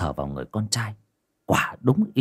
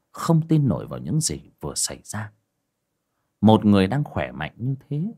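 ordinary delivery

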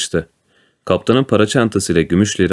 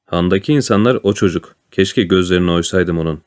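usual speaking voice

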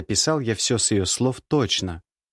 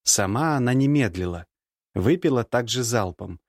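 usual speaking voice

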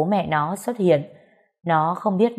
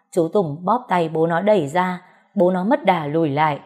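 ordinary delivery

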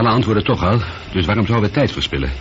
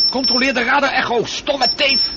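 much higher, snapping order; angry command. Acted. Astronaut cuts off the computer, higher angry order. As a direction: cut her off with contempt. Man cuts her off angrily.

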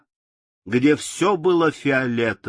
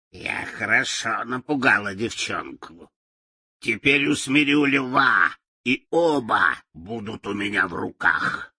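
gloating witch; 3 semitones lower than usual. A narrator's reading.

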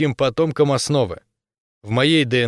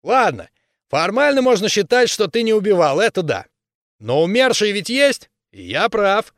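higher, loud arguing voice; heated berserker; 9 semitones higher, 4 dB louder than usual. Acted. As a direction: argue hotly like a brawler. Berserker argues loudly.

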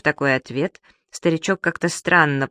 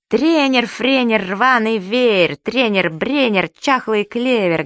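loud, high teasing chant; cocky taunt; 6 semitones higher, 3 dB louder, slower than usual. A narrator's reading. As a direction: bawl a slow mocking rhyme across the pool. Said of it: "голосил Субастик". (задиристо голосить дразнилку)

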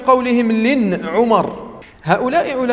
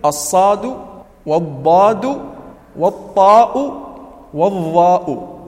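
slow, emphatic naming of each letter; slow, drawn-out recitation of the letters; slow letter listing; slower than usual. Shown as a demonstration. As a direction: stretch out each letter name. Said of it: "وهي".

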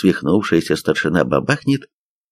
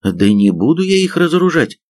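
higher-pitched grumbling refusal; reluctant grumble; 7 semitones higher than usual. A narrator's reading. Young soldier refuses to disarm officers, grumbling. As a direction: grumble a mild protest. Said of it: "пробурчал Женька".

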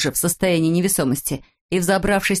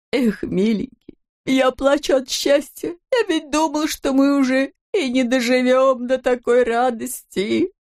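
high, slow weepy voice; tearful happiness; 6 semitones higher than usual. A narrator's reading. Shorty crying from happiness to Vintik, tearfully. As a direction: weep the words out, drawn out and high. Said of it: "залился слезами".

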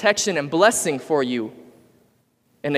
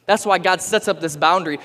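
ordinary delivery

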